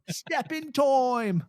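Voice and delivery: high, slow, drawn-out mock Cockney. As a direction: stretch it into a hammed-up film Cockney.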